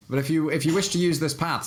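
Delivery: lightly voiced horrifying gnome; a hint of a creepy gnome. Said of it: "says horrifying four-color gnome".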